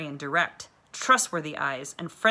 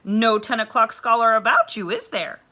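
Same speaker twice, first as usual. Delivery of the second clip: a hint of a hearty teacher, a bit louder and higher; jolly teacher; 4 semitones higher, 8 dB louder than usual. A narrator's reading. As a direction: tease like a friendly schoolteacher. Teacher figure greets Janey.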